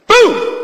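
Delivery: sudden loud, high-pitched boom; explosive boom. Retold or imitated. Doctor imitating the defibrillator shock, explosive. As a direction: burst out with a booming jolt.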